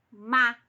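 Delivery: "ma" loud and drawn out; stressed syllable. Shown as a demonstration. Teacher pointing out the strongest syllable, "ma" stressed loud and long. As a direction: punch and lengthen "ma". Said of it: "a sílaba mais forte".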